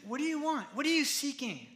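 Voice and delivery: high-pitched, sincere question without any shouting; sincere asking. Shown as a demonstration. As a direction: ask it as an open invitation. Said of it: "He said".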